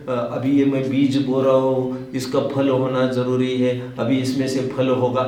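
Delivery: एक सुर में रटता किसान; बीज बोते किसान की तरह यंत्रवत रटते हुए, सपाट सुर में; रटने जैसा. Acted. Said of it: "रटता जाता हो". (रटंत अंदाज़ में, बिना उतार-चढ़ाव)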